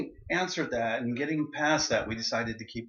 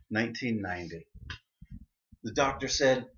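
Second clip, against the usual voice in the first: usual speaking voice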